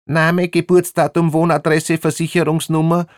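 high, loud official rattle; high-pitched bureaucratic rattle; rattled off. A narrator's reading. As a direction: fire off the list in a piercingly high voice. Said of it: "ratterte es aus der Zizi Warek hervor".

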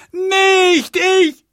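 high, loud, desperate scream; screaming girl. A narrator's reading. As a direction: scream it in terror. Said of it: "schrie das Mädchen". (verzweifelter, schriller Schrei)

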